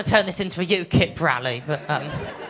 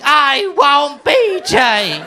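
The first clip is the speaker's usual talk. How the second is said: put-on high, loud, slow kid's scream; child screaming; 8 semitones higher, 8 dB louder, much slower than usual. Small boy at the ballgame screaming for his hero, high and loud. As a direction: scream it like an overexcited seven-year-old.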